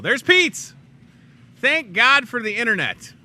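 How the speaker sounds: high, loud delight; real burst of excitement; excited exclamation. Real, not acted. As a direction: cry out at a happy discovery. Delighted as picture appears on screen.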